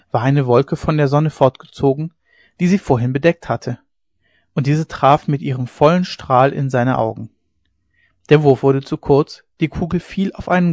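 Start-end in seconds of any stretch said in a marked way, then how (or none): none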